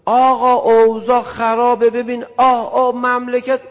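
loud, slow groaning whiner about the country; hammed-up lament of a grumbler; mock complainer. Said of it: "two sides being unfair".

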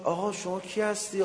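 suddenly amazed, asking who he is; astonished question. Acted. Woman sees the cow revived. (با تعجب و حیرت)

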